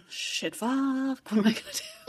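hushed, airy mock panic. Conman realizing his lie worked, mock panicked. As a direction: panic under your breath, imitating him.

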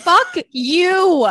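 mad. Retold or imitated. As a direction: spit it out like you're fed up. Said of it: "I was so mad".

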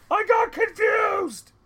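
yelled apology; yelling. Acted. Apologising for the wrong movie, still yelling. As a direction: shout it high and drawn out. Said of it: "Why are you yelling at us?".

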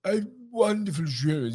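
high, drawn-out royal impression; King Charles. Then-Prince greeting the producer after the show, mimicked royal voice. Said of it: "he came up to me and he said hello".

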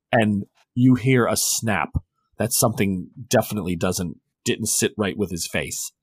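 usual speaking voice

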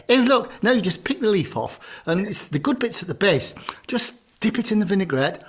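hammed-up know-it-all host; smug dinner party host. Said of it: "they think they're being ever so clever".

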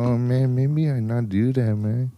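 put-on slow, timid Mexican-accented voice; timid Mexican baby. Answering his conscience, timid mock Mexican accent. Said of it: "He's a timid Mexican baby".

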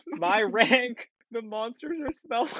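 giggling through a retold line; laughing while quoting the line. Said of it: "Piper walking away with the comedy".

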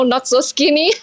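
normal talking voice

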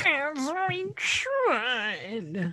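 high, very slow, drawn-out cat voice; cat person voice. Pretend. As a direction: purr it out like a cartoon cat. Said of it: "should we just do cat person voices".

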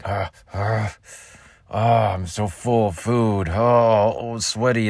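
drawn-out, overheated moans and groans; hammed-up sweaty moaning, stuffed with food; Sigourney Weaver moaning. Comic retelling of being possessed on the bed, hammed-up moaning. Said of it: "I was making all these, like".

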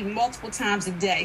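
ordinary talk

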